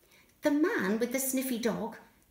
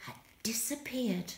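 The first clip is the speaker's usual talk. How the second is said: whispered softly; 9 dB quieter than usual. A narrator's reading. Narrator telling children Billy vanished, whispered. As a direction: whisper the mystery. Suspenseful reveal.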